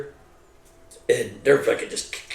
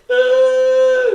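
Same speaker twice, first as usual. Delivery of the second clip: high sharp pained yelp; wounded soldier crying out; 6 dB louder than usual. Retold or imitated. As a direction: cry out from a wound.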